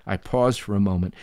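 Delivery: usual speaking voice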